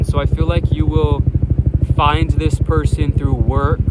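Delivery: yelling over helicopter. Real, not acted. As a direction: shout to be heard over the rotor noise. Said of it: "Excuse the helicopter".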